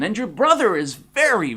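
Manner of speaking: ordinary delivery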